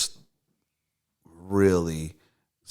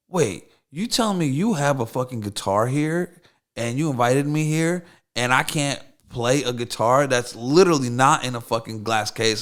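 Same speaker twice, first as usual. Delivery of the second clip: raised pitch and volume, in disbelief; incredulous protest; 7 semitones higher, 6 dB louder than usual. Retold or imitated. Guest retelling his protest to the guitar's owner, incredulous. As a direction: argue it as an absurd injustice.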